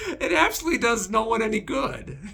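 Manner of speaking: real laughter through quick words; amused chuckling as you explain; laughing. Real, not acted. Vlogger laughing about Jesus scaring disciples, amused. Laughs at his own point.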